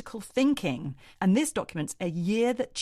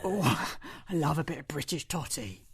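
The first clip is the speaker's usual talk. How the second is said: low, softer husky smoker's voice; Maggie Gyllenhaal impression; 4 semitones lower, 3 dB quieter than usual. Host caps the joke in a low smoky impression. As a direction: purr it like a hoarse party girl. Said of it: "that's my Maggie Gyllenhaal impression".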